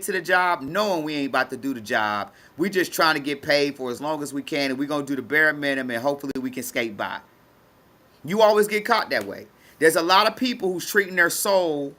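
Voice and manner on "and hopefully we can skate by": ordinary delivery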